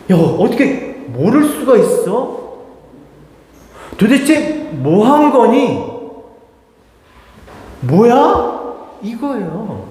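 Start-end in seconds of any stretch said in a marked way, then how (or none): 0.1-8.5 slow, high-pitched mock scolding; exasperated rebuke. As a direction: draw out the disbelief as you scold. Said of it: "이게 다 이거예요". (답답한 듯 길게 끌며 다그치는 흉내)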